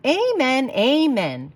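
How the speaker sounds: a lightly voiced congregation answering amen; chorused amen. Passengers answering the bus voice, a light chorus. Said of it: "chorused the passengers".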